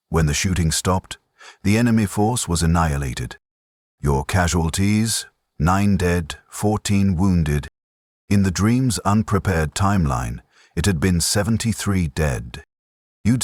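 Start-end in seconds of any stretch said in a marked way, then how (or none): none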